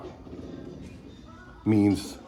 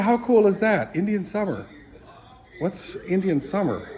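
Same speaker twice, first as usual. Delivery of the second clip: retold surprise; 8 semitones higher than usual. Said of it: "I said".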